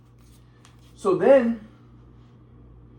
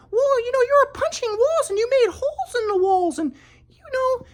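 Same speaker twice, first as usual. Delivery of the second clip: high mock old-lady voice; grandma brushing off his abuse, high mocking voice. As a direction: mimic grandma much higher, dismissive. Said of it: "my grandma's like".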